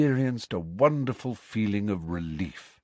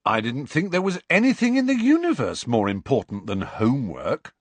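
Ron teasing; 5 semitones higher, 5 dB louder than usual. Acted.

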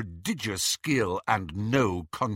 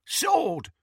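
grunted. Acted. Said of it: "grunted an out-of-sight snatcher".

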